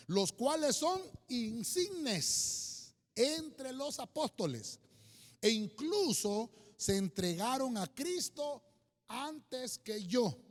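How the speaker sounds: very slow, emphatic Bible reading; deliberate, weighty reading with pauses; scripture reading. Acted. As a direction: proclaim the verse, drawing out each phrase. Reads scripture aloud.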